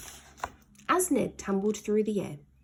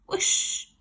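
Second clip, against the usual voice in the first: breathy whoosh; whoosh effect. A narrator's reading. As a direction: blast it out with a whoosh. Cannon shot.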